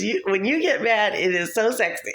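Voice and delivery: high-pitched imitation of a teasing brother, breaking into laughter; teasing, laughing.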